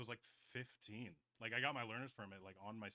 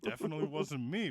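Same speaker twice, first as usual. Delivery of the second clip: loud, high fake innocence; a hammed-up innocent lie to his mom; mock innocent denial; 5 semitones higher, 13 dB louder than usual. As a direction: swear it wasn't you, loud and high. Denying joyriding to his mom.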